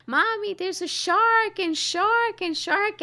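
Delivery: put-on high, slow little kid voice; imitating a frightened small child; little daughter crying to mommy about a shark; scared child.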